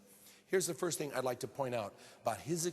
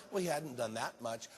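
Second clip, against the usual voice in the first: usual speaking voice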